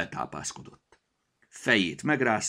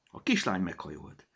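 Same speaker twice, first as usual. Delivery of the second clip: plain reading voice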